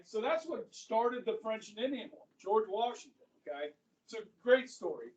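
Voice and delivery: ordinary talk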